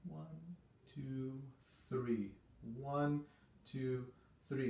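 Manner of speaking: soft, sweet beat count; tender legato one two three. Shown as a demonstration. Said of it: "sort of sweet feeling three beat pattern".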